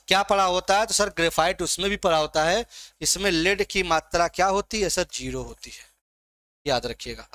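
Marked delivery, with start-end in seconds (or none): 5.1-7.3 soft answer. Real, not acted. Teacher answering own quiz question.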